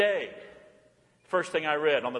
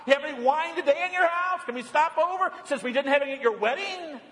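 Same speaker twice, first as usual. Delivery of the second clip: high, teasing mock request; mocking a guest; 7 semitones higher than usual. Preacher mocking guests who want wine, teasing. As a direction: poke fun at the cheeky wedding guest.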